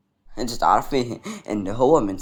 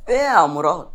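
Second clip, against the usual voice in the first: calling Murad, raised and louder; 3 semitones higher, 7 dB louder than usual. Acted. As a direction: call out to get his attention. Calls out to Murad. (نداء بصوت أعلى على مراد)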